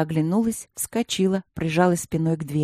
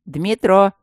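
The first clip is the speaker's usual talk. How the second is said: louder call of the name; 3 semitones higher, 6 dB louder than usual. Acted. Матвей зовёт Дмитро отойти от двери, громко. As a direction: call him to step aside. Matvey calls Dmitro aside.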